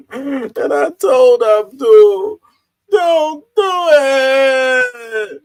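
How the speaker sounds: put-on high, slow old man through laughter; laughing while doing the old man voice. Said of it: "the old man voice works really well".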